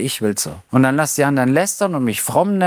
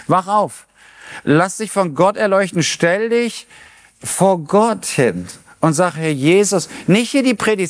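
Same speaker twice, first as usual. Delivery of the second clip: higher, urgent sermon voice; fervent preaching; 5 semitones higher than usual. Acted. Preacher urging the congregation to stand before God, fervent. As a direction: drive the call to God home.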